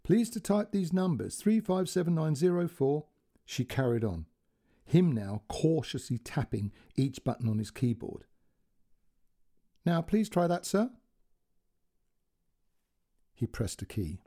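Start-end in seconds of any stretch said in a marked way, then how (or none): 0.0-3.1 lightly voiced polite operator, higher; careful polite dictation of numbers; a hint of an Indian call centre voice; call centre girl. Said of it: "Now she said". 9.9-10.9 lightly voiced polite operator, higher; careful polite dictation of numbers; a hint of an Indian call centre voice; call centre girl. Call centre girl's quote.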